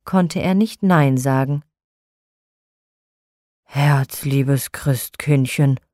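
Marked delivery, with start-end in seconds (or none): 3.6-5.8 slow, lower, melting tenderness; tender call. Acted. Nikolaus won over by the Christ child, tender and slow. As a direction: give in fondly, drawing each word out. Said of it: "rief er".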